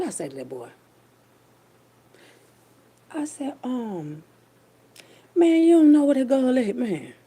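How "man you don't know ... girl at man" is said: higher, louder pressing the guy; pressing question. Retold or imitated. To the hearse driver about the missing girl, pressing loud. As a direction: lean on him like you suspect him. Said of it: "that's how I said it".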